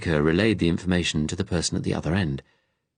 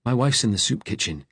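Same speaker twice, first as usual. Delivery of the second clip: higher, earnest appeal; urgent plea, voice raised in pitch; pleading; 6 semitones higher than usual. A narrator's reading. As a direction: beg him to do as you say. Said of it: "his tone suddenly pleading".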